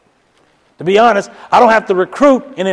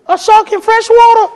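much higher, louder disbelieving question; incredulous; 6 dB louder than usual. Retold or imitated. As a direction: scoff at the shark claim. Doubter hears shark story.

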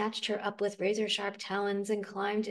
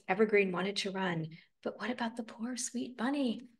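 usual speaking voice

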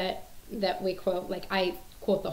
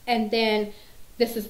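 ordinary talk

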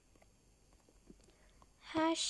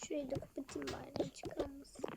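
whisper; 11 dB quieter than usual. Acted. Sneaking out quietly.

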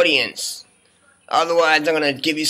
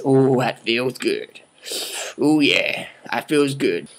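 savoring; 5 semitones lower, slower than usual. Pretend. Savoring holding a semi-automatic.

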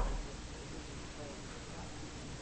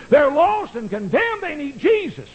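pleading climax; 11 semitones higher than usual. Acted. Preacher's rising climax.

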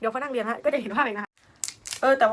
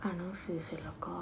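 whisper; 3 semitones lower, 11 dB quieter than usual. Real, not acted. Trailing off planning.

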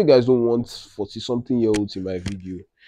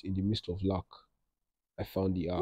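softer, murmured trial read of the caption; hushed first run of a caption; quiet caption read; 12 dB quieter than usual. Shown as a demonstration. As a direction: try the caption line under your breath. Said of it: "I'll now caption it".

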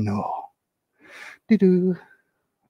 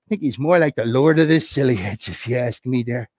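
usual speaking voice

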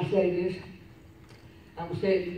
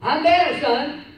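excited phone chat; 8 semitones higher, 6 dB louder, slower than usual. Retold or imitated. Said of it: "phone talk with son".

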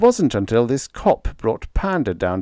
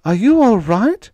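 much higher, louder frightened squeal; anxious squeal; 13 semitones higher, 8 dB louder than usual. A narrator's reading. Panda anxiously squealing at Freddie after the match. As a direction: squeal it out in panic. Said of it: "she squealed".

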